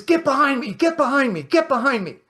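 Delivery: loud, high-pitched rebuke, each repeat hammered; retold rebuke. Born-again coworker rebuking the speaker as Satan, loud mock outburst. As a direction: imitate someone forcefully casting out Satan. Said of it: "And he says".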